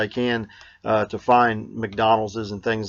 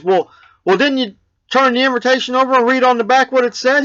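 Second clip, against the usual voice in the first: neighbor quizzing; 13 semitones higher, 5 dB louder than usual. Acted. Neighbor voiced in joke.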